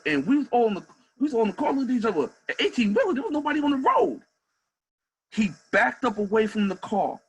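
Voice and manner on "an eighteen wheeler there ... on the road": higher and louder in disbelief; can you believe this; incredulous. Real, not acted. Coach recalling the bogus traffic stop, incredulous. Disbelief at cop's false claim.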